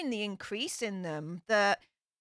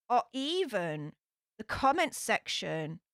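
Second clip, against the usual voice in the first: ordinary delivery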